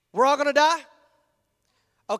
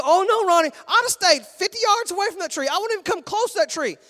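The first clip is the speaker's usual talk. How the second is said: higher, louder, hammed-up holier-than-thou voice; mock pious protest; 7 semitones higher, 5 dB louder than usual. Preacher mocking a churchgoer who claims he'd never sin, hammed-up pious. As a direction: play the self-righteous churchgoer to the hilt.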